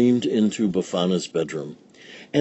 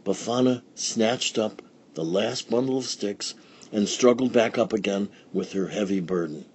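usual speaking voice

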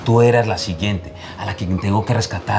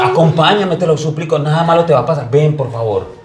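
higher, louder pleading; man begs the frightened woman to follow him, urgent and higher; pleading urgently; 7 semitones higher, 4 dB louder than usual. Acted. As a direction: beg her to come with him. Begging her to come.

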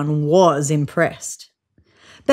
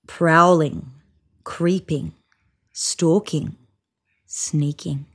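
lower, fading to a whisper; creeping fade; 3 semitones lower, slower than usual. A narrator's reading. Storyteller building suspense as lion creeps, fading to whisper. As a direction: sneak the words away to nothing.

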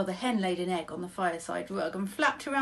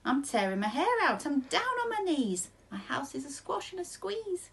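put-on fretting old lady; 7 semitones higher than usual. A narrator's reading. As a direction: cry out in a high fretful voice. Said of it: "The little old lady cried".

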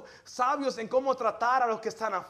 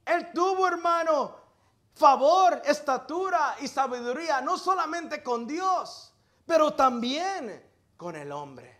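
fiery preaching; 4 semitones higher, 5 dB louder than usual. Acted. Preacher stirs up his congregation, fiery preaching. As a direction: drive the point home to the congregation.

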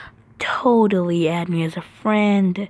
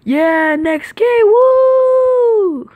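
loud high whoop of victory; cheering; 13 semitones higher, 9 dB louder, much slower than usual. Real, not acted. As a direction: celebrate the win out loud. Celebrating win.

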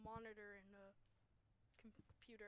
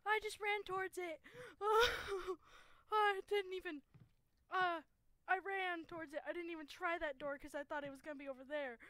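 high, loud, breathless panic after a fright; panicked rush; 7 semitones higher, 15 dB louder than usual. Real, not acted. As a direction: blurt it out still in shock. Panicked after a jump scare.

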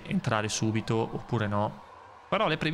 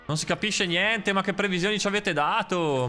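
exasperated; 8 semitones higher than usual. Real, not acted. Baffled by the weather forecast.

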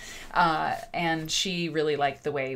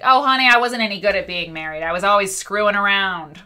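put-on louder, brassy old-lady Barbara voice, unapologetic; imitating Barbara; 4 semitones higher, 12 dB louder than usual. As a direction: do Barbara confessing without shame, loud. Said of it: "she talks like this".

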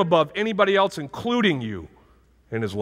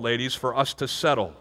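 ordinary talk, nothing marked

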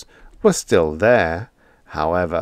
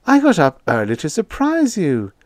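much higher, bright and eager; higher eager landlady; cheery landlady; 10 semitones higher, 3 dB louder than usual. A narrator's reading. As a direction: beam as you announce it.

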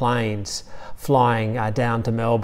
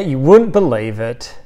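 normal talking voice

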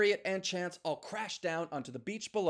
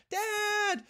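calling out; 4 dB louder than usual. Acted. Chance calling for his father.

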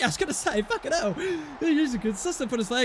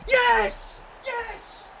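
elated cheer; 11 semitones higher than usual. Real, not acted. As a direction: cry out in high-pitched joy. Celebrating in the shootout.

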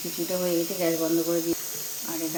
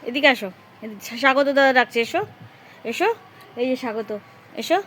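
loud high calls to the cats; high raised calling to the cats; 7 semitones higher, 10 dB louder than usual. Real, not acted. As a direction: call them over across the roof.

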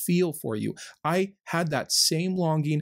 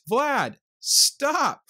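sharp, much higher and louder cry of frustration; heated outburst; 11 semitones higher, 4 dB louder than usual. Real, not acted. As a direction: snap at Vlad, fed up and exasperated. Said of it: "Sorry I got so heated on this one".

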